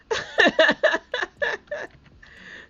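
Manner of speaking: long, high, loud burst of laughter; rising howl of laughter. Real, not acted. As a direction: crack up hard at the joke. Laughing after "call me Gilf.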